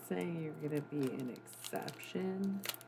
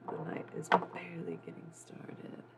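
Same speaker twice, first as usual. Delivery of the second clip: whisper; 2 semitones lower than usual. Real, not acted. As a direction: whisper it close to the mic. Hushed late-night stream talk.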